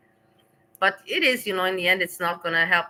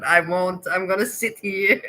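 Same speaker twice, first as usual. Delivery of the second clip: usual speaking voice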